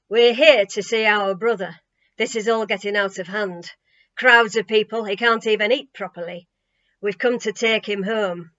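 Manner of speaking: loud, insistent bossy complaint; bossy ordering. A narrator's reading. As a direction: lay down the law, loudly. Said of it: "carried on ordering Tammy about".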